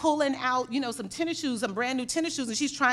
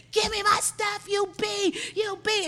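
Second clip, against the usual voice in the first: retold screaming; 9 semitones higher, slower than usual. Caught shoplifter demands her stuff back, retold high screaming. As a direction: imitate a furious shopper's screech. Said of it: "she just kept screaming and screaming".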